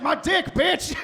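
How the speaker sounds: high, loud, rough shout; screaming patient in his face, mock yell. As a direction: bark it like an unhinged patient. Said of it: "he's like".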